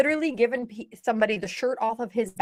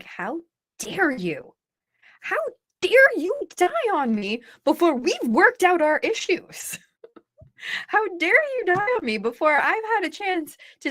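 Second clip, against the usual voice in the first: indignant, higher-pitched tirade; retold angry rant, emphatic and high; angry outburst; 6 semitones higher than usual. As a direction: lay into someone who died on you, pitch climbing. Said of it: "brought up a lot of anger".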